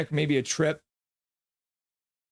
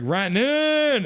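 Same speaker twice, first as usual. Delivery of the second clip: "Rantanen" drawn out much higher and slow; swooning fan; 11 semitones higher, 4 dB louder, slower than usual. Retold or imitated. Voicing a chatter's message, high swooning croon. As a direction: croon the name adoringly. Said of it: "Big Mac's like".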